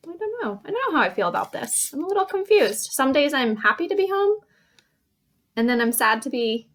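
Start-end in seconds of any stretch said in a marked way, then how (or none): 0.1-2.9 acting confused. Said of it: "Confused is like".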